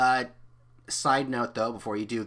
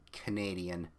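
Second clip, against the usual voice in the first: plain reading voice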